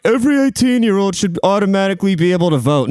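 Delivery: a hint of a campaigning politician, sped up; Bernie Sanders impression. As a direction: lightly send up a preachy senator. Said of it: "Where he's like".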